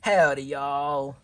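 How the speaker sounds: slow, loud Texan drawl; a slow, loud Texas greeting; Sandy greeting everyone in a slow, loud Texan accent. Acted. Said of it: "Here comes Sandy Cheeks".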